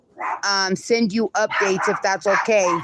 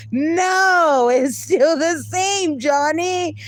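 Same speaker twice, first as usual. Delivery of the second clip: much higher, slow, cracking with laughter; laughing cry; 8 semitones higher, much slower than usual. Real, not acted. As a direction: whine through the giggles at Johnny. Laughing protest about raisins.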